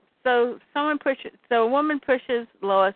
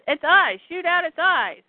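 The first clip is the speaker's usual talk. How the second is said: high urgent battle shout; urgent call; 7 semitones higher than usual. Acted. Lois telling fighters where to shoot, urgent call.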